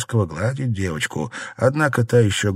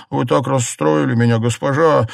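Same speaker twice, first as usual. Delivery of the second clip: higher, louder protest; upset exclamation; 5 semitones higher, 4 dB louder than usual. A narrator's reading. Maid protests to her mistress, exclaiming in distress. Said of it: "воскликнула Пинер".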